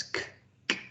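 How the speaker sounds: k- sounded alone, twice, with pauses; bare k- consonant, repeated before the word; initial sound isolated. Shown as a demonstration. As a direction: sound out the hard k- that starts chaos. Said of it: "So things like chaos".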